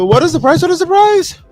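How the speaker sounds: baffled outcry. Real, not acted. Can't find the price on screen.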